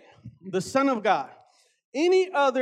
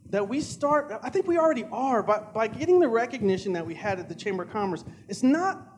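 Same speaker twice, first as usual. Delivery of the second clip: ordinary talk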